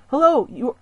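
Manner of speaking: ordinary delivery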